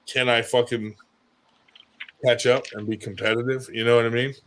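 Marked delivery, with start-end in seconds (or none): none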